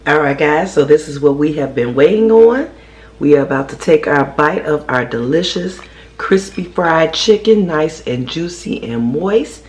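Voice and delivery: loud, eager build-up before tasting; excited. Real, not acted. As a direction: hype it up, voice rising on chicken.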